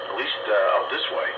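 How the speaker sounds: ordinary talk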